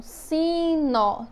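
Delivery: "sino" slowed into si, nó; syllable by syllable. Shown as a demonstration. Teacher summing up two syllables, "sino" said slowly in parts. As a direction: stretch each syllable of "sino". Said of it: "duas sílabas".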